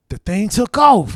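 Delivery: normal talking voice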